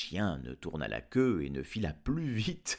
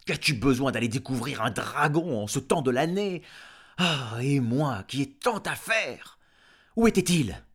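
loud, higher furious farmer; angry farmer Giles; 6 semitones higher, 8 dB louder than usual. Acted. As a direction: blow up at the dog. Farmer explodes at dog. (Gilles le fermier furieux, crie fort)

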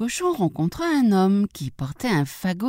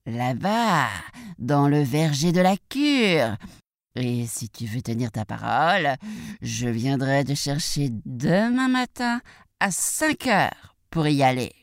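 coaxing wolf; 2 semitones lower than usual. Acted. Wolf sets up apple outing.

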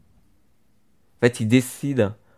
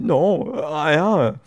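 high mock-tearful denial; hammed-up tearful brush-off; imitated swallowed sobs; 6 semitones higher, much slower than usual. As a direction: pretend to choke back big sobs, voice pitched up. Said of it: "ses gros sanglots, elle dit".

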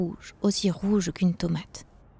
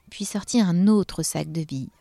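ordinary delivery

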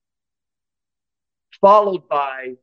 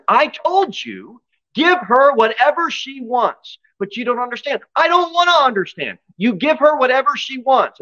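much higher, shrill scolding mom; a spoiled rich mother losing her temper; furious mother; 13 semitones higher than usual. Acted.

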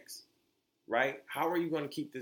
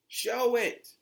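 a louder second demand; louder insistent repeat; 9 semitones higher, 7 dB louder than usual. Real, not acted. Host demanding brands prove it, louder. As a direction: push "Show it" harder and louder, landing on "Show".

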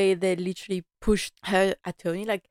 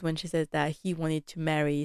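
ordinary delivery

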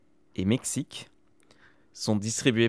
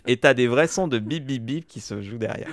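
usual speaking voice

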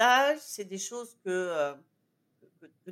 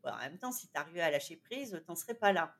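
low, softer inner-critic aside; little inner voice; 6 semitones lower, 6 dB quieter than usual. Acted. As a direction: lightly voice a quiet, nagging thought. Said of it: "une petite voix qui me dit".

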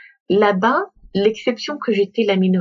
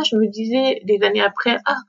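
normal talking voice

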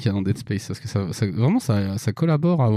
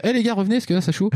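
high-pitched urgent call to the guys; mock call for help; 8 semitones higher, faster than usual. Player yelling for teammates to return, high and urgent. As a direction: call the others back, pitched way up.

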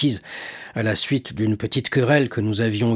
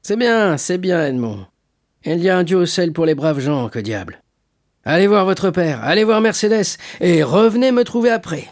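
high, animated, jovial urging; hearty shipowner, brisk kindness; 6 semitones higher, 4 dB louder than usual. Acted. Shipowner waves off Edmond's gratitude, hearty. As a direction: brush off thanks and send him away.

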